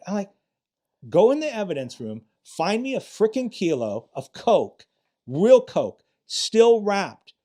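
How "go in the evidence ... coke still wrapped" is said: loud, high, punched-out order; emphatic demand. Retold or imitated. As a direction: retell an exasperated order, hitting each phrase harder. Retold demand for real coke.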